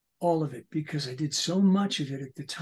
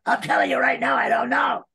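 much higher, louder insistent shout; shouting character; 9 semitones higher, 10 dB louder than usual. Retold or imitated. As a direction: yell it out, exasperated. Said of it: "talk to myself".

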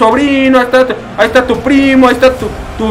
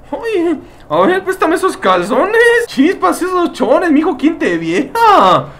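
mock flirty voice; 7 semitones higher, slower than usual. Teasing shirtless Spartans.

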